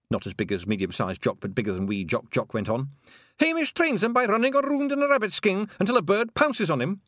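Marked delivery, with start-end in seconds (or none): 3.4-7.0 high Scottish pixie voice, louder; cheeky wee Scots pixie. A narrator's reading. Feegle pixie voiced in story.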